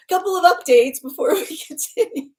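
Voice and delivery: can't keep a straight face; laughing through it. Real, not acted. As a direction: still laughing while trying to move on to the updates. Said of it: "A couple of updates before we continue".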